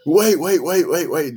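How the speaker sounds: high alarmed wait; urgent stop. Retold or imitated. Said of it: "And he goes".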